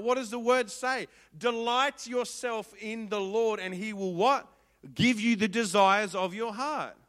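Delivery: loud, high preached scripture; preaching at full force. Real, not acted. Preacher leading the congregation through a verse, loud. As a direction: drive the verse home.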